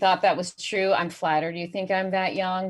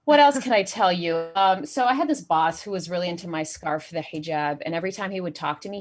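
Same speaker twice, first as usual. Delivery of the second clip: ordinary talk, nothing marked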